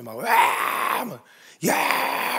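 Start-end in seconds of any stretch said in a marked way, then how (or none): 0.2-1.0 long drawn-out mock yell "waaa"; imitated bellow. Goliath starts hollering from the empty field, imitated bellow. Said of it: "고래고래 막 소리를 지르기 시작한". 1.7-2.4 high, stretched-out mock shout "yaaa"; imitated yell. Goliath yelling at the army, high imitated shout. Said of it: "막 소리 지른 거예요".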